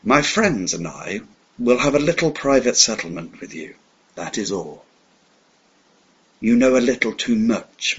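lightly voiced old schemer, purring a threat. Villain threatens captive hero.

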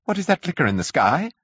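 high, sudden question; abrupt curate. A narrator's reading. Curate abruptly points out the sky flicker, high. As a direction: blurt it out abruptly. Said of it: "he asked abruptly".

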